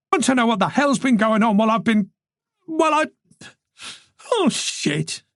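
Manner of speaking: high fast words then collapsing; losing it mid-sentence; breaking down. Acted. Tess to Moran as tears overtake her, voice breaking.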